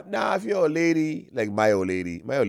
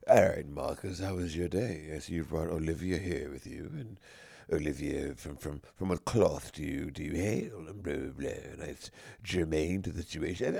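low, soft, proper dinner-table voice; mock genteel parent questioning; posh polite family; 10 semitones lower, 10 dB quieter than usual. Mocking the stiff family asking about her, low and soft.

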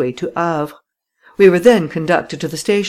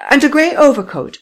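high, loud, insistent woman's voice; insistent lady; 10 semitones higher, 6 dB louder than usual. Acted. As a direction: press the point stubbornly. Voices Madame Renaud.

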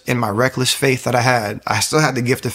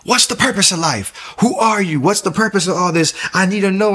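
high, desperate cries at the sky; anguished shouted pleas, pitched way up; retold screaming; 8 semitones higher than usual. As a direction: scream the questions up, pleading, much higher. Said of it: "profusely crying, just screaming".

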